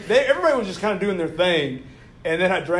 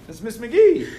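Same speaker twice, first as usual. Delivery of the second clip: higher, louder silly voice with laughter; Eric's defensive imitation; 5 semitones higher, 5 dB louder than usual. Eric explaining his bad imitation to Hyde, in silly high voice. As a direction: insist on a hopeless impression. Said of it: "He's like".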